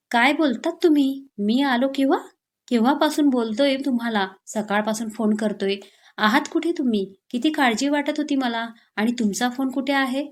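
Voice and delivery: usual speaking voice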